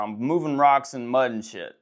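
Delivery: ordinary delivery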